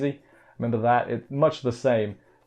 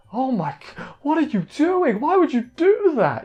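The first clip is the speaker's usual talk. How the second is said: much higher, rising to a near shriek, imitating horror; horrified; 13 semitones higher, 3 dB louder than usual. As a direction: squeal it out like someone watching something gruesome. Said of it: "it's just horrified".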